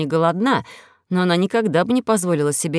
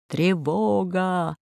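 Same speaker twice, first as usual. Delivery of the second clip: drawn-out, slow automated alert; loudspeaker alarm voice; much slower than usual. A narrator's reading. Station challenges an approaching ship, slow loudspeaker voice. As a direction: stretch it out like a station announcement. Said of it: "прозвучало в динамике".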